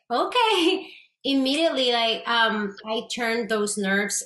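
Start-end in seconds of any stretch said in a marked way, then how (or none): 0.1-0.9 mock okay. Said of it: "and I was like".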